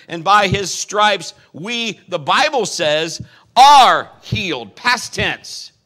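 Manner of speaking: loud, rising preacher's cry; full-force preaching. Acted. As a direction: preach it hard to the congregation. Preaching healing scripture at full force.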